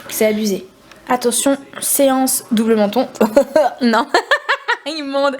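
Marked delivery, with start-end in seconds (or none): 4.1-4.9 high burst of laughter. Real, not acted. Double chin filming.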